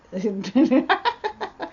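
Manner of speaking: high rising burst of laughter; real, high-pitched laughing fit. Real, not acted. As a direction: break up laughing at the joke. Joking about Sasquatch and goats.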